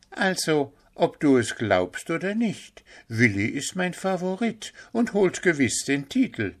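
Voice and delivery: high, bright-eyed deer voice; eager young deer. A narrator's reading. Deer Pünktchen defends Willi to Puh, high eager voice. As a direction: insist with admiring enthusiasm.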